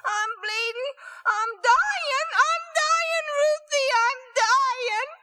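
put-on a terrified child shrieking he's dying; sobbing shrieks of panic; crying scream. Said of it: "just crying and screaming".

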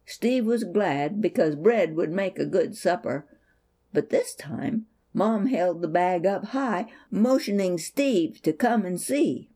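plain reading voice

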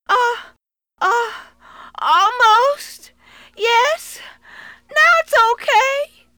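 put-on high, tearful little boy; child voice. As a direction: answer up, straining. Trapped boy answers.

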